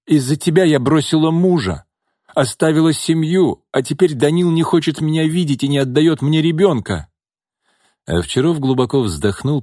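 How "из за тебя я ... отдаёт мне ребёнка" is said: higher, louder, aggrieved woman; complaining woman. A narrator's reading. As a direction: list the grievances, wounded. Nina complains.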